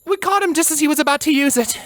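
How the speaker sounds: high, fast soldier voice with labored breath; strained breathless explanation. A narrator's reading. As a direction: rush the report while still winded. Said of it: "the soldier explained through her still strained breaths".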